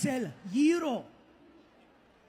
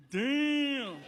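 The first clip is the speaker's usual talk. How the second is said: higher, drawn-out damn; impressed disbelief; 4 semitones higher than usual. Acted. Friends seeing a big guy board the elevator, amazed. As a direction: stretch it in mock amazement. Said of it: "you're like".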